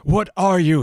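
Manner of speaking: demanding. Acted. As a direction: read it like a frantic scientist demanding an answer. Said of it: "he demanded".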